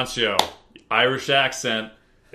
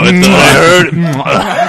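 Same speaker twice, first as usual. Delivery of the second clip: laughing; 4 semitones higher, 14 dB louder, much slower than usual. Real, not acted. Laughing mid-line.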